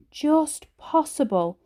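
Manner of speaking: ordinary delivery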